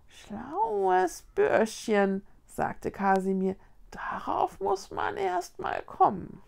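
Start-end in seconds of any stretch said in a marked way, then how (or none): 0.1-2.2 higher, very slow, drawn-out cat voice; admiring tomcat. A narrator's reading. Tomcat praises the boy's homemade sheet, drawling admiringly. 3.9-6.4 high, airy, whispery cat voice; admiring tomcat. A narrator's reading. Tomcat admires the boy's idea, whispery.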